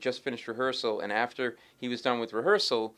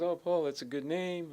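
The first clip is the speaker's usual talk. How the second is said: higher-pitched, lightly imitated friendly star; 7 semitones higher than usual. Music star greets a fan, higher imitated voice. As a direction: greet the stranger in a higher put-on voice. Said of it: "He goes".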